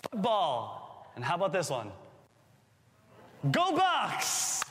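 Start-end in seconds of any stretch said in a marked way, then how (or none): 3.5-4.6 cheer. Pretend. Cheering for the Buckeyes.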